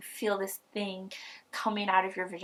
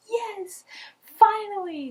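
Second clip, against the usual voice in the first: relieved joy; 7 dB louder, slower than usual. Retold or imitated. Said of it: "And I'm like".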